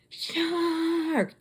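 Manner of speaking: high, drawn-out scream without full volume; held-back, high-pitched alarm cry; mock screaming. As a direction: imitate panicked swimmers screaming. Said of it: "They start screaming".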